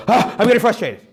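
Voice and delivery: high, loud exasperated groan; frustrated caregiver. Retold or imitated. Impatient adult fed up with a fidgety toddler, exasperated. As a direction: let the frustration burst out. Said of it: "the good go".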